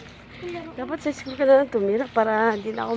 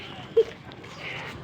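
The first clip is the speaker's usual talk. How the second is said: breathy laugh; 6 dB quieter than usual. Real, not acted. Mother laughs.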